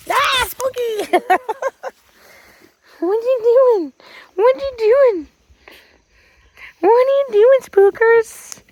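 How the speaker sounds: high excited doggy talk. Real, not acted. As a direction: gush over the puppy. Excited baby talk to dog.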